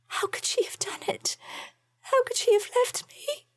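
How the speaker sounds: starts in a high whisper, then a high, voiced rising plea; a young woman's high, weeping voice asking why; tearful lament. A narrator's reading. Joanna weeping over her cat.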